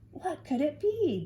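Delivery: high, fretful question; worried child. Acted. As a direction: worry aloud in a kid's voice. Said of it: "Drew worried".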